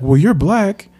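put-on high, light boyish retort; kid's comeback. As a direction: snap back like a playful third-grader. Friend's retort, quoted.

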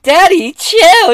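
teasing woman. Retold or imitated. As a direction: coo it up high, brushing him off. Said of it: "She was like".

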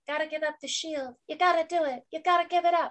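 high, soft, pleading mock voice; mock plea. Mocking injured Lore begging from the ground, high and soft. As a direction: whimper the plea in a thin put-on voice.

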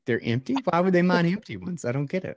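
high, fast, loud fake confusion; mock naive believer. Mocking a BSV fan who can't see why blocks are empty, in a high fast confused voice. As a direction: play a bewildered true believer, over the top.

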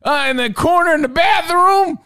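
high, loud mocking mom shout; teasing mom voice. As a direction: belt it out like a mom teasing a guest.

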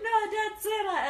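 put-on high, drawn-out kid's protest; whiny protesting kid. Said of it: "when the kid's like".

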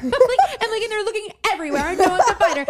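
high-pitched, breaking into laughter; laughing. Real, not acted. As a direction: laugh through the words. Laughing while retelling the search.

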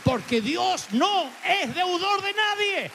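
slow, high, ringing declaration; full-force pulpit proclamation; preaching climax. Real, not acted. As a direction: draw it out and lift it high.

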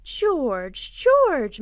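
second name leaps high and loud; a wife's urgent, rising appeal; urgent plea. A narrator's reading. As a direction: call the husband's name twice, escalating sharply. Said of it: "Missus Darling whispered".

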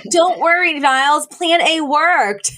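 high, gleeful reassurance, slowing on plan A; smug glee. Retold or imitated. Parents tell butler Niles their plan worked, gleeful. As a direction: gloat sweetly at the butler. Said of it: "they're like".

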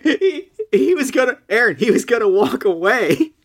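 real giggly voice; laughing through it. Real, not acted. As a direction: talk while cracking up. Laughing out the words.

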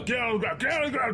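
high-pitched commanding yell at normal volume; mock yell. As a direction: order them down, pitch climbing. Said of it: "I'm just gonna yell".

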